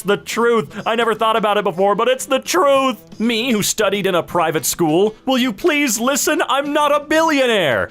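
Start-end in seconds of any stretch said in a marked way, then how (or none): 5.3-7.9 high, pleading character voice; mock protest. Billionaire meme character protesting in a high voice.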